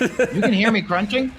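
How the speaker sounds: high, amazed rising question; surprised. Real, not acted. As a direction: ask it in sudden disbelief. Disbelief at being heard eating.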